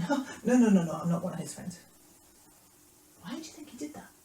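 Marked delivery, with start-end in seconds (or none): 0.0-1.8 loud, fast flustered denial; Peter's hasty denial. Acted. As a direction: deny it quickly and forcefully. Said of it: "Peter went".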